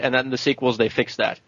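usual speaking voice

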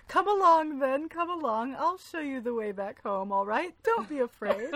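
put-on high, loud coaxing voice to a lost kid; mock coaxing. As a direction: coax him home like a scared child, hammed up. Voicing Fitz coaxing the fool.

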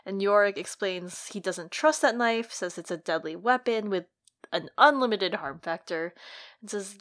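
ordinary delivery

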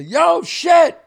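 high, loud call up to a window; yelling out window. Retold or imitated. Kids calling their friend down from the street, shouting.

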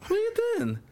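high-pitched questioning mother; bewildered mum. Retold or imitated. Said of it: "She said, she said wet".

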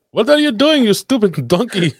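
put-on high-pitched, outraged Indian-accented shopkeeper; mock Indian shopkeeper yelling. Store clerk catches a shoplifter, mock-angry Indian accent. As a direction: scold in a hammed-up Indian accent. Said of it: "when the guy yelled at me".

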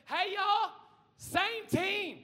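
high-pitched call from the sidelines; acted courtside holler; sideline yeller. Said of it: "on the sidelines that's going like this".

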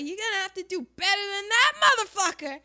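loud, high, fast mock threat; acted furious taunt; defiant. Beaten fighter rises and taunts.

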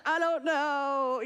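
put-on a child dragging out "I don't know" slowly; slow drawn-out child. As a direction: draw out the words like an innocent child. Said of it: "She's like".

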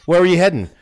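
stern cop asking; a hint of a cop questioning. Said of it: "like a cop's taking notes".

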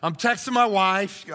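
ordinary talk, nothing marked

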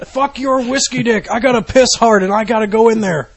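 put-on high, insistent drunk complaint; mock drunk at the door; belligerent drunk. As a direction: whine loudly like a drunk locked out.

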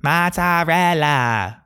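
high, drawn-out, hammed-up Italian accent; douchey Italian accent. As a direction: sing out each syllable like a fake Italian. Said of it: "put an Italian accent and try super hard".

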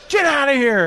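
high mock shout; angry dismissal. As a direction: send the mascot off as an exasperated host. Host dismisses mascot, acted.

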